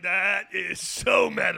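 slow loud hype shout. Acted. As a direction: draw it out, loud and awed. Mock impressed reaction.

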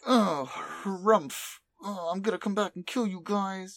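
mock-petulant threat in a high voice; huffy Delta. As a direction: huff like a thwarted anime villainess. Grumbling Delta retold.

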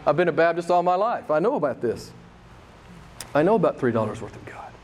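ordinary delivery

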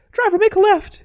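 very high, fast squeaky cartoon mouse; Mickey Mouse voice. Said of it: "He's like".

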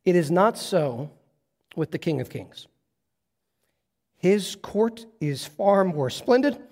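reading Spurgeon aloud. Acted. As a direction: read the old preacher reverently, lingering on phrases. Then Spurgeon says it like this.

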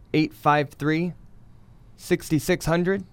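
slow, measured digits in spaced groups; slow number dictation. Real, not acted. Recruiter giving the phone number, slow dictation. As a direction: give listeners time to write it down.

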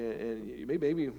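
ordinary talk

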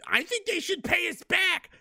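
high, slow naive whine; mock a gullible American; hammed-up high whine. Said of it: "And you're like".

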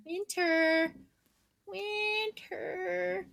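high soft drawn-out call of Winter; softly calling the sleeping dog. Real, not acted. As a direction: coo her name gently up high.

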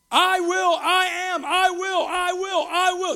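high, loud repeated I will; shouted promise. Acted. Pastor voicing God's vow to Joshua, shouting. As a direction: hammer each I will out.

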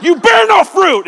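high, loud hammed-up rebuke; mock yelling. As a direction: yell at a fig tree in comic fury. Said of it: "He yelled at a fig tree".

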